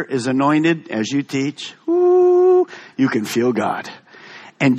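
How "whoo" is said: high, sustained "whoo". Real, not acted. Preacher thrilled by the anointed teaching, high whoo. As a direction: let out a high, steady whoo. Interjection on feeling God in teaching.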